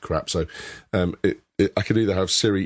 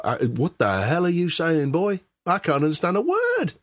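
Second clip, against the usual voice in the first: imitated Louisiana accent; 11 semitones higher than usual. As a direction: mimic a bewildered Louisiana local, pitched way up. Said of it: "Is that Louisiana that's in or something".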